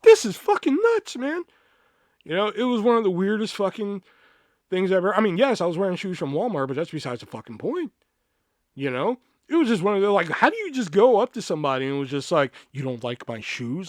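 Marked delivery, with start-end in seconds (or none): 0.0-1.4 loud, high, incredulous exclamation; exasperated outburst. Retold or imitated. Exasperated reaction to the accusation. 12.7-13.9 low, slowed, soft challenge; confrontational mimicry. Repeats the girl's confrontation.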